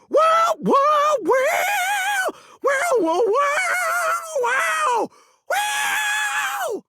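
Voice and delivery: very high wailing mouth guitar; a cappella guitar solo. Acted. Singer who can't play guitar, wailing vocal solo. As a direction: squeal out guitar licks by voice. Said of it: "I got this guitar solo".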